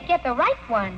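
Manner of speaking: normal talking voice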